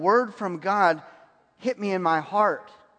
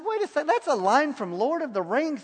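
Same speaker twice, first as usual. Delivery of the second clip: mock disbelief; 7 semitones higher than usual. Retold disbelief at a borrowed prophecy.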